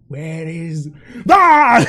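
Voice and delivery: nervous question then a yelp; jumpy and on edge, then jolted; startled cry. Retold or imitated. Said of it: "Brudrin's on high alert".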